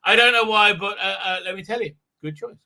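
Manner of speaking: ordinary talk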